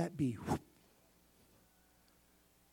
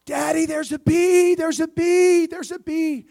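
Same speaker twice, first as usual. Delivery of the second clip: put-on high, loud panicked child's scream; top-of-lungs scream; 14 dB louder than usual. Little girl warning her dad of a bee, screaming. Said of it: "she screamed at the top of her lungs".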